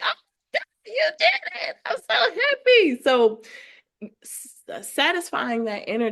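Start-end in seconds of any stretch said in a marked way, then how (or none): 0.0-3.0 put-on high childlike voice, delighted. Little [name] answering her grown self, high childlike delight. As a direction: squeal it like a thrilled little girl. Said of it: "And she'd be like".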